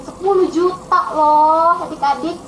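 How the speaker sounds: ordinary delivery, nothing marked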